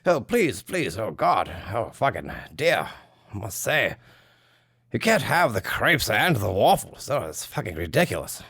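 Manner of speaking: a flustered British gentleman declaring war; hammed-up upper-class English accent; posh British. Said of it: "Britain steps in here and they're like".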